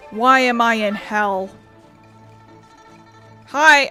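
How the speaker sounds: ordinary talk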